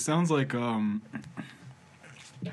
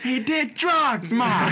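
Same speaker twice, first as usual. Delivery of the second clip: high, slow, louder drawn-out whine; whiny son; 11 semitones higher, 6 dB louder, much slower than usual. Pretend. Friend joins in as the son, high slow whine. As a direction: stretch it out like a fed-up son. Joining mock son role.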